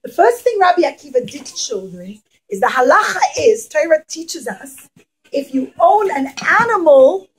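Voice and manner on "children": ordinary delivery, nothing marked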